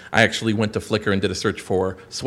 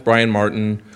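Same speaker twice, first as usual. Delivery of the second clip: ordinary talk, nothing marked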